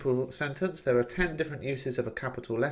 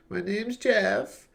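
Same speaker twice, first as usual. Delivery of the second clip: higher, slow, lightly put-on voice of the man pictured; voicing Jeff; 10 semitones higher, much slower than usual. Teacher voicing the man in the picture, lightly put-on higher voice. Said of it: "would be Jeff".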